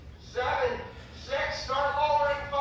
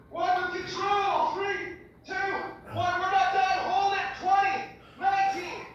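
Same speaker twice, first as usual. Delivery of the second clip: rally the class through the last hold; pumped-up coach push; 6 semitones higher, slower than usual. Real, not acted. Fitness instructor pushing the class through the last plank, pumped-up and higher.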